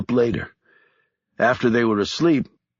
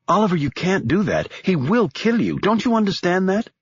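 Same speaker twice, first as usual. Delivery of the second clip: higher, urgent frightened woman; frightened Annabelle; 9 semitones higher than usual. Acted. Annabelle begs Stone not to face Bagger, high and urgent. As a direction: plead with him, scared for him.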